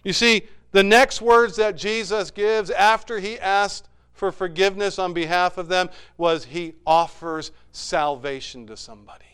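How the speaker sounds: ordinary delivery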